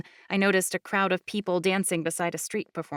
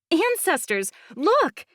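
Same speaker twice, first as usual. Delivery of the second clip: high, loud excited call; excited shout; 9 semitones higher, 4 dB louder, slower than usual. Acted. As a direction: call out in sudden wonder. Imogen excitedly points upward.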